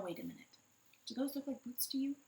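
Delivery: usual speaking voice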